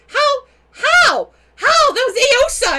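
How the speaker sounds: high, loud, stunned cries of how; astonished. Retold or imitated. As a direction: disbelief climbing with each how. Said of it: "She's like".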